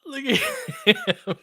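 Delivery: slow, high, laughing with delight. Real, not acted. Fan marvelling at a young player, laughing. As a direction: laugh it out in disbelief.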